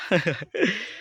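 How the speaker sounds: quick amused chuckle; a light laugh at own joke; short laugh. Real, not acted. Joking about autofocus. (tawa singkat)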